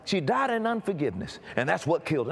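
ordinary delivery

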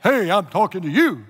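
loud, booming mock voice of God; James Earl Jones. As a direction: boom it out like a voice from heaven. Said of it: "deep-faced James Earl Jones voice".

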